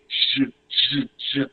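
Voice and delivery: "J, j, j" soft and quiet; softly voiced French "j"; soft G sound. Shown as a demonstration. Teacher models French G for students, soft G sound. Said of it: "it is a soft sound".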